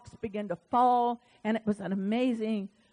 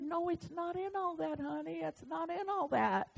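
much softer, tender reassurance; God's gentle word; 5 semitones higher, 10 dB quieter than usual. Acted. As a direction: soothe her like a loving parent. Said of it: "I get this word".

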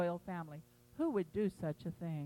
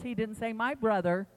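ordinary delivery

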